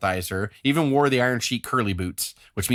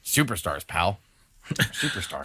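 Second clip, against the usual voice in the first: low, deep announcer drawl on Superstars; lightly voiced promo guy; mock wrestling announcer; 4 semitones lower, 5 dB quieter than usual. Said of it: "We're not wrestlers".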